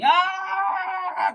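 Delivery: high piercing wail of despair; anguished scream. Retold or imitated. Tamar runs off torn clothes, high anguished scream. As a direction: cry out as Tamar in grief. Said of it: "se fue gritando así".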